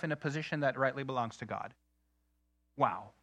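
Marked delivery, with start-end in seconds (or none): none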